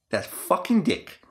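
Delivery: usual speaking voice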